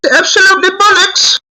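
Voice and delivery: very high falsetto British accent. Shown as a demonstration. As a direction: squeal it in a put-on British accent. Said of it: "my British accent".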